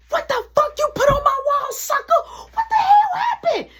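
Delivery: high, loud furious mom shout; mom yelling. Retold or imitated. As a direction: screech at the ruined wall like an outraged mother. Mom flips out about wall.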